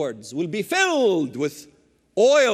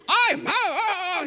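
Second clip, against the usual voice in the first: mock-furious sputter, high and slow; hammed-up speechless rage; sputtering fury; 10 semitones higher, slower than usual. Al Gore choking with anger at the ambassador, mock sputter. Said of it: "He's furious".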